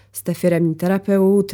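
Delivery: ordinary delivery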